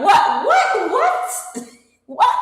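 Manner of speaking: shocked disbelief. Retold or imitated. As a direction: squeal in mock outrage at the price. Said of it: "I'm like".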